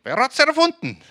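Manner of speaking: high, loud triumphant Swiss voice; Swiss man from the ad. Retold or imitated. Preacher acting the Ricola Swiss man, high and loud. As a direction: belt it out like the Swiss man popping out of the pot. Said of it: "kommt dieser Schweizer ... und sagt".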